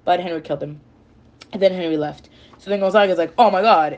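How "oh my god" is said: freaking out. Retold or imitated. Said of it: "he's, like, freaking out".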